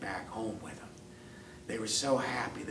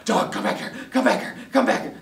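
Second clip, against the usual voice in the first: loud, breathless repeated calls; chasing dog; 11 semitones higher, 11 dB louder than usual. Acted. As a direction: yell after a runaway, panting. Dog chases running cat.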